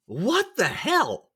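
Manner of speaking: high, slow incredulous exclamation; stretched, high-pitched mock bewilderment; retold disbelief. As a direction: draw it out in baffled disbelief. Retold reaction to girlfriend's choice.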